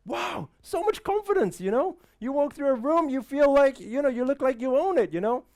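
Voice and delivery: a lightly voiced, starstruck admirer; admiring fan. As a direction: gush over him like an admirer. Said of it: "they say".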